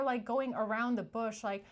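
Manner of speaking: ordinary talk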